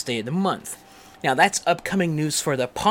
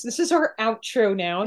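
ordinary talk

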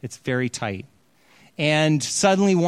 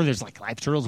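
aghast sister; 4 semitones lower, 4 dB quieter than usual. Retold or imitated. Sisters staring at live turtles, lowered, aghast disbelief.

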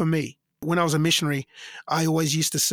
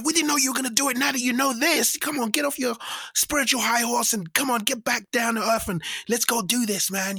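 high, coaxing persuasion; pushy bandmate voice; 6 semitones higher than usual. Retold or imitated. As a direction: urge the singer to drop his mission.

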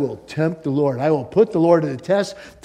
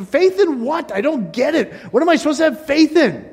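much higher, baffled questions that speed up at the end; confused believer; 11 semitones higher, 4 dB louder than usual. Acted. Preacher mimics a confused believer, baffled and high. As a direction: play the puzzled churchgoer throwing up his hands.

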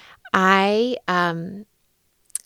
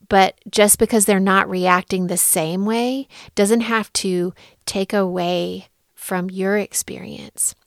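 ordinary talk, nothing marked

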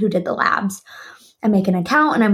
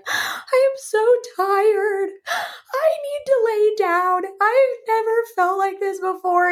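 retold crying, pitched way up; mock bawling; 13 semitones higher than usual. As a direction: wail it like an exhausted, weepy wife. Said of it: "I just started bawling".

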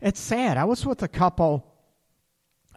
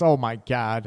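ordinary delivery

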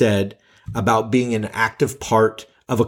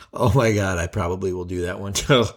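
usual speaking voice

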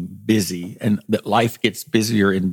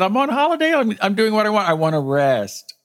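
high-pitched retold insistence; 10 semitones higher than usual. Retelling the aunt's mother's retort.

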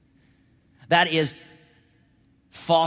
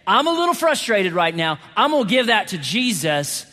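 pitched-up, driving sermon delivery; full-force preaching; 5 semitones higher, 5 dB louder than usual. Real, not acted. Preacher tells church what real love is, forceful preaching. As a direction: build each clause emphatically for the congregation.

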